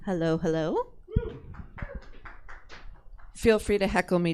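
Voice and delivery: ordinary delivery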